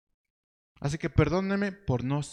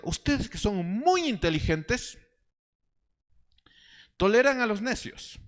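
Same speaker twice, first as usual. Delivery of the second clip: mock praise; 9 semitones higher than usual. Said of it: "Todo esto de manera muy irónica".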